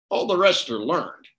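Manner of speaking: ordinary delivery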